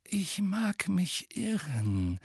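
mild and courteous; amiable. A narrator's reading. Dumbledore politely objecting to Fudge, amiable. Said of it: "sagte Dumbledore liebenswürdig".

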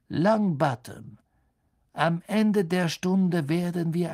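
langsame, erhobene Lehrerstimme voll Hohn; drohender Snape. A narrator's reading. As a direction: die Drohung genüsslich dehnen. Snape droht Neville. (höhnisch drohend, gedehnt)

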